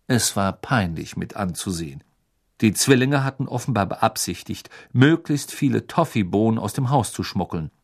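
plain reading voice

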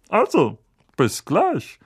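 gruff uncle. A narrator's reading. Vernon leaving.

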